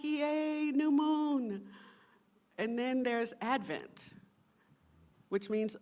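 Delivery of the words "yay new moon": high, bright playful yay; congregation's mock excitement at the new moon, high and cheery; mock cheer. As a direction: cheer like a delighted kid. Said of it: "And so we're like".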